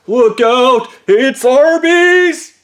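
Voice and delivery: high, loud, drawn-out warning cry; mock alarm. Warning of women and their tacos, mock-alarmed yell. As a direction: holler a hammed-up warning, stretching the last word.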